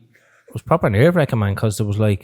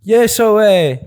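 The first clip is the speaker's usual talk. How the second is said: high, loud mock interviewer asking earnestly; high, loud, hammed-up interviewer reading from his book; 10 semitones higher, 5 dB louder than usual. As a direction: squeak out the question like an over-keen host. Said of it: "he's like".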